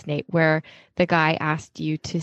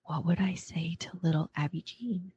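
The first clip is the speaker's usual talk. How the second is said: whispered; 9 dB quieter than usual. Retold or imitated. Asking herself in the mirror.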